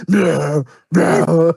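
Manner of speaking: loud mock crunching grunt; straining grunts. Acting out Mr. Warren crushing ice with his chin, straining grunts. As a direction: grunt hard with the effort, twice.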